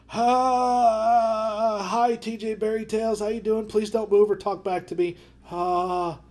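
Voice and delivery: nervous voice. Retold or imitated. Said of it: "I'm like".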